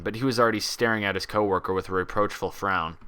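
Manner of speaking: plain reading voice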